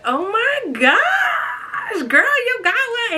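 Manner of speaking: high shrieking gush of excitement; retelling her own loud excited reaction; excited squeal. Retold or imitated. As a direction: squeal it like you just heard big news. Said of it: "So I was just like".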